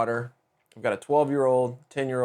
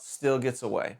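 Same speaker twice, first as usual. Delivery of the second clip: usual speaking voice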